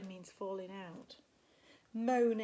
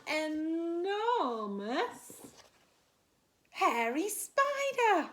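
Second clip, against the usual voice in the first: very slow, rising enormous; reading to children, building suspense; dramatic stretch; 7 semitones higher, 8 dB louder, much slower than usual. A narrator's reading. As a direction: draw out enormous for effect. Drawn-out dramatic word.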